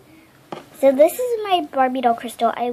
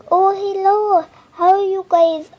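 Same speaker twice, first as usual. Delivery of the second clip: Nikki doll voice; 6 semitones higher, 5 dB louder than usual. Acted. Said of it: "This is Nikki".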